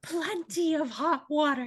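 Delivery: high, indignant repeat; outraged mocking echo. Shriek starts the chase, high mocking outrage. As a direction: hurl the excuse back at them.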